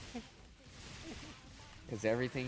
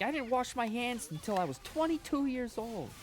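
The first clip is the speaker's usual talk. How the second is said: much higher mock comedian rant; Orny Adams rant; 14 semitones higher than usual. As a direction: yell it like a fed-up comic on stage. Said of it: "he's like".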